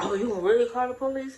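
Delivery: loud, taunting retold challenge; mocking her partner. Partner taunting her about calling police, loud mock. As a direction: throw the taunt back loudly. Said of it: "She was like".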